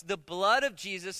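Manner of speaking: normal talking voice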